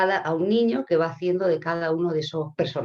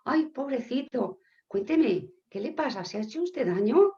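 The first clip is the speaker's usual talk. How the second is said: higher, cooing sympathy; sympathetic concern; 7 semitones higher than usual. Acted. Señor Romero to the fallen policeman, acted tender concern. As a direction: fuss gently over someone who fell. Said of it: "se acerca al policía y le dice".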